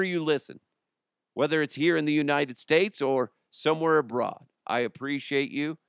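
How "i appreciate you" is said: usual speaking voice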